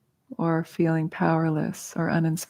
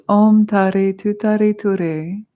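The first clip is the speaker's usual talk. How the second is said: slow, louder intoned syllables; mantra recitation; 2 semitones higher, 6 dB louder, slower than usual. Shown as a demonstration. Teacher introducing Tara's mantra to the group, slowly intoned. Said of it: "her mantra is".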